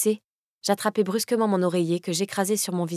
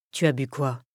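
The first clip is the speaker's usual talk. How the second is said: stern Kaz; 6 semitones lower than usual. Acted. Kaz questions her, frowning.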